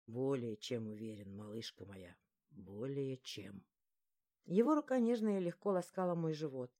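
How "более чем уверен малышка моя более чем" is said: very low, soft man's voice; reassuring male lead. A narrator's reading. He reassures his girl he is sure, low soft murmur. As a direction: murmur it deep and slowing to the end.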